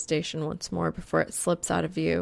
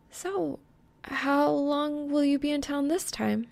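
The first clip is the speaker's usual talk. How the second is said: put-on light, raised young woman's voice; higher girl voice; 9 semitones higher than usual. A narrator's reading. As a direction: lift into her bright questioning voice.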